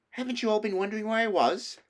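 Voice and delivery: put-on high, amazed girl's voice; a young girl puzzled that nobody missed her; in amazement. A narrator's reading. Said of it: "said Lucy in amazement".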